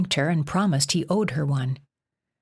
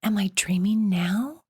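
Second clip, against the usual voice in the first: whisper; 4 semitones higher than usual. A narrator's reading. Jen asks the dream boy if she's dreaming, whispering. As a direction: breathe the question, dazed.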